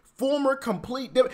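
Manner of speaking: normal talking voice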